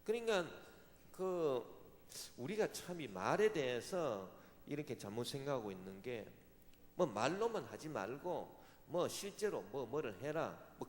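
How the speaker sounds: ordinary talk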